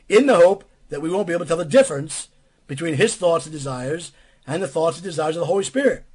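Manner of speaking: usual speaking voice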